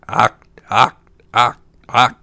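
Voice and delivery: loud, short frog croaks; punchy frog cries with gaps between; croaking frogs. A narrator's reading. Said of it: "The frogs ... but still cried".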